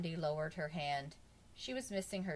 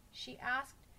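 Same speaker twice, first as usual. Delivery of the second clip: plain reading voice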